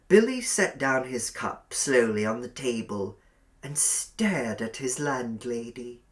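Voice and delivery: usual speaking voice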